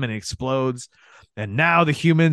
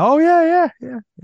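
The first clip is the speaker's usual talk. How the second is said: excited; 4 dB louder than usual. Real, not acted. Emphatic agreement.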